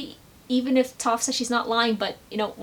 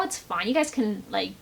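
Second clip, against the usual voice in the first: ordinary talk, nothing marked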